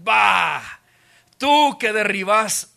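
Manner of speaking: loud, slow scornful shout; scornful jeer. Acted. Passers-by shouting scorn at the crucified man. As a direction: spit out the insult.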